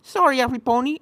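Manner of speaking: hasty shouted apology; apologetic shout. A narrator's reading. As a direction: shout a quick sorry on the run. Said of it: "Apple Bloom shouted".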